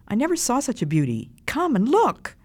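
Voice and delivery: high-pitched, delighted exclaiming; excited child's cry. A narrator's reading. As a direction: marvel like a child, swooping up high. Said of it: "cried the first child".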